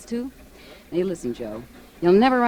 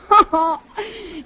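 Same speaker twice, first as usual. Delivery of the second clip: a quick high teasing laugh; 11 semitones higher, 6 dB louder than usual. Acted. Belle laughing at Joe's manuscript, teasing. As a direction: laugh at his stuck heroine.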